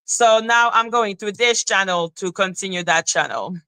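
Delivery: usual speaking voice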